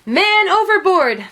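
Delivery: high, loud alarm call; sailors' cry. A narrator's reading. Sailors spot the monkey in the water, high loud cry. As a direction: raise the alarm for the whole ship. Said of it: "the sailors cried".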